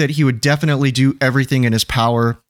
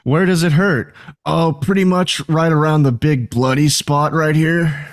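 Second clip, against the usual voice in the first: put-on, higher movie-quote voice; hammed-up comedy dialogue, raised pitch; quoting a movie character; 4 semitones higher than usual. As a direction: act out a silly film exchange. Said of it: "That was from Kung Pao".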